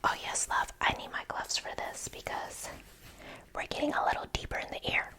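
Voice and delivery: ordinary delivery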